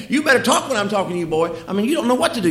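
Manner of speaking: loud, fast high scolding; rapid stern lecture at the boy; angry parent. Retold or imitated.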